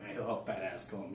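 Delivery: low, soft retold thought; private grumble. Boy thinking as mom comes for him, low quiet grumble. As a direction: grumble it under your breath.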